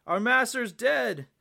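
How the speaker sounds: slow, high, loud wail of alarm; anguished shout. A narrator's reading. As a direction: cry it out, stretching each word. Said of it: "shouted the three young samurais".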